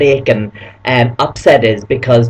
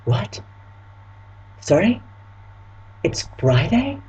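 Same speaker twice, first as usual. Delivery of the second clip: quiet, higher questioning; mock puzzled; 5 semitones higher, 9 dB quieter, slower than usual. Pretending to miss the news before the reveal, puzzled. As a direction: feign not hearing, rising at the end.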